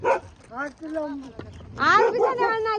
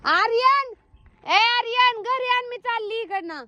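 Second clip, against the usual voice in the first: calling out; 8 semitones higher, slower than usual. Real, not acted. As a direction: call out so it carries, pitched high. Calling Aryan from afar.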